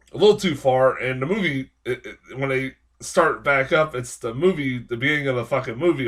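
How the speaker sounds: ordinary talk, nothing marked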